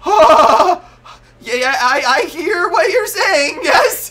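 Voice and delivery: high, loud, quavering cry; shaking voice, reenacted. Man answers the voice while shaking violently, high quavering cry. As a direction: force the words out through violent shaking. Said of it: "My voice is, like, shaking".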